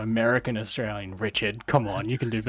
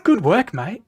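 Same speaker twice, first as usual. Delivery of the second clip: high, loud mock-praise film quote; movie character reply; 9 semitones higher, 9 dB louder, slower than usual. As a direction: imitate a comedy dad cheering on his kid. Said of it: "Like, what a movie".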